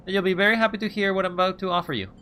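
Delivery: quick salesman pitch. Acted. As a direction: rattle off the offer like a hustler.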